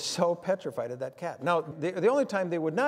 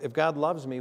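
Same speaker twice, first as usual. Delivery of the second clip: ordinary talk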